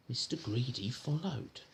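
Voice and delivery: plain reading voice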